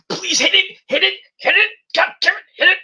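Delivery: high-pitched begging, over and over; pleading to hit it. Retold or imitated. Fan begging the wrestler to hit his move, high pleading. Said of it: "I was like".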